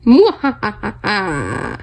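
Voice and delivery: hammy villain cackle; evil laugh. Pretend. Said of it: "let me do my evil laugh right now".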